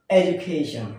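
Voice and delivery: "এডুকেশন" said as "ej-u-KAY-shn"; proper pronunciation. Shown as a demonstration. Teacher giving learners the proper form, "এডুকেশন" as ej-u-KAY-shn. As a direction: say "এডুকেশন" with a j sound for the d. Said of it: "আবার কি?".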